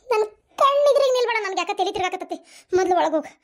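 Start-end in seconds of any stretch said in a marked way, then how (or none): 0.1-1.4 louder, higher, upset outburst; distraught angry shout. Acted. Repeats order, escalating. 2.8-3.3 firm command, falling at the end; stern order. Acted. Mother orders daughter indoors.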